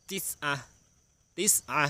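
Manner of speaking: ติสอะ high, in two separate syllables; model pronunciation. Shown as a demonstration. Teacher shows children Arabic nine, ติสอะ pronounced high and clearly. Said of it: "ออกเสียงว่า".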